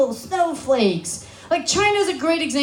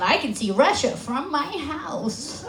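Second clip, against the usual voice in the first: slow, softer mock politician voice; Sarah Palin; 2 semitones higher, 6 dB quieter, slower than usual. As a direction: drawl it out in a sing-song impression. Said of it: "Sarah Palin".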